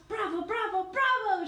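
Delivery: high, loud cheering bravo; crowd shouting bravo. Acted. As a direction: roar approval as the crowd. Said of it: "shouted the rest of the circus people".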